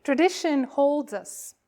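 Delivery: slow, solemn liturgical reading; call to worship. Acted. Minister calling the congregation to worship, solemn and slow. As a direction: invite the congregation in gently.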